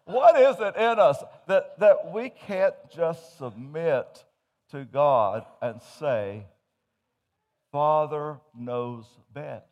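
loud, slow pulpit appeal; preaching. Acted. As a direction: plead with the congregation, drawn out. Preaching at full force.

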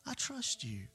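tender reassurance. Acted. Neighbor hushing the frightened boy, soft and tender. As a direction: gently hand the boy the precious book.

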